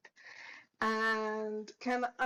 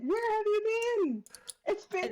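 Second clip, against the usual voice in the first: much higher, beaming question; joyful exclaim; 11 semitones higher than usual. Acted. Fides beaming at the old friend, high and joyful. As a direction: beam and squeal the question. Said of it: "It's like".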